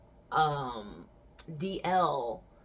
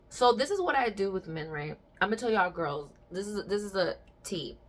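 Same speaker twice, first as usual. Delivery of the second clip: usual speaking voice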